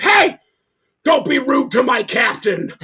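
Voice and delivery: loud, high indignant yell; drunk buddy's outburst. Retold or imitated. Drunk buddy defending his captain to the singer, indignant yell. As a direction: imitate a drunk man hollering in protest.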